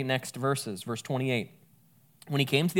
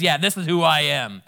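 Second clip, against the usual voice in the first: gruff veteran; 2 semitones higher, 7 dB louder than usual. Retold or imitated. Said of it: "a veteran who's really gruff and burly".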